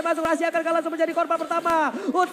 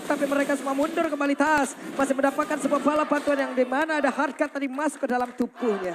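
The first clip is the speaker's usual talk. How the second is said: normal talking voice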